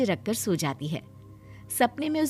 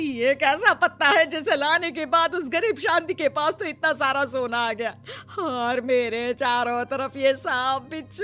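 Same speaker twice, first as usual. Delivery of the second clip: higher, distraught complaint; frightened wailing; 4 semitones higher than usual. Acted. Woman cheated by the magic leaf, frightened wailing. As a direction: wail in dread and disbelief. Woman surrounded by snakes, scorpions.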